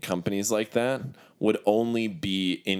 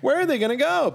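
much higher, exasperated repeat. Acted. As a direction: throw the question up high in frustration. Repeats question, second time raised.

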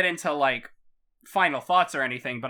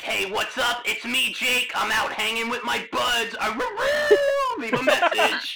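dude voicemail voice; 7 semitones higher than usual. Retold or imitated. Jake voicemail parody.

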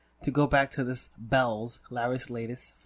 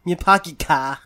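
put-on louder Boston accent, "car" as "kah"; 9 dB louder, slower than usual. Friend hamming the Olive Garden slogan in Boston accent. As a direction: drop the r in "car" like a Bostonian, loud. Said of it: "with a Boston accent".

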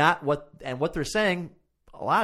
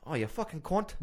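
softly put-on British accent, higher; mock British accent; 4 semitones higher, 6 dB quieter than usual. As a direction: try the swear in a British accent. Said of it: "say it in a British accent".

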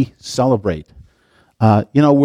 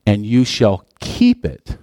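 slow, leaning hard on "keep"; stress on keep; 3 semitones lower, slower than usual. Shown as a demonstration. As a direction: draw out "keep" as the key word. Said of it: "important word".

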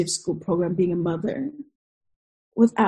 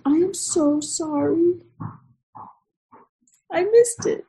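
high-pitched, drawn-out sorry to her son; slow, high retold apology; heartfelt apology; 10 semitones higher, slower than usual. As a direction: apologize gently, pitch lifted well up.

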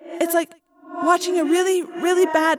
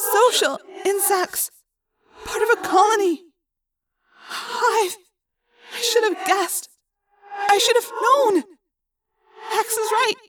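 shattered; 14 semitones higher, 4 dB louder than usual. Acted. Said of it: "sounding shattered".

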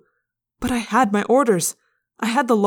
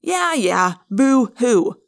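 mocking fake sympathy; sneering; 4 semitones higher, 5 dB louder, much slower than usual. A narrator's reading. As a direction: scoff at his sob story. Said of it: "Marco sneered".

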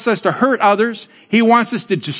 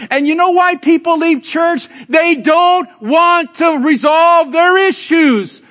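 preaching shout; 8 semitones higher, slower than usual. Real, not acted. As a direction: drive the point home at full voice. Preacher hits the point.

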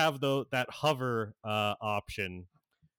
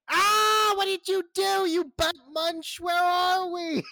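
put-on high, loud panicked kid yell; Gizmo yelling; 7 dB louder than usual. Gizmo yells at Cyborg after the crash, high panicked shout. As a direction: shriek it like a frantic little sidekick. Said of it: "he's like, starts yelling".

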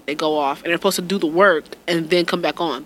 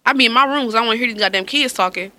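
ordinary delivery

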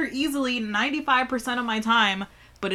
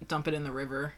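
normal talking voice

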